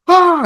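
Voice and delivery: a quick hammed-up scream; mock scream. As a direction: yelp in mock terror at the morphing head. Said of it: "he just starts screaming".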